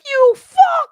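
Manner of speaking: loud, high imitated curse; angry. Imitating angry friend cursing at Dave, high loud fury. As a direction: spit out a screeched insult as her. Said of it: "when she was angry".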